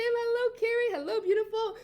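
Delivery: high, sing-song hello; excited greeting. Real, not acted. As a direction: greet them high and delighted. Greeting viewers by name.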